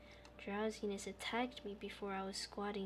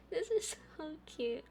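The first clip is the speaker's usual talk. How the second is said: laughing coo; 7 semitones higher than usual. Real, not acted. Laughs and coos.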